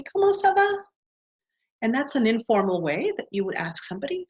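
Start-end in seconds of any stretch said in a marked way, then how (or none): 0.0-0.8 model question. Shown as a demonstration. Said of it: "you could say".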